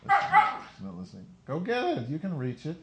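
high coaxing voice to a pet; calling to a pet. Real, not acted. Owner coaxing a pet to fetch, high. Talking to dog or pet off mic.

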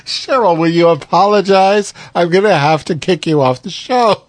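mock scolding. Mock demand for an apology.

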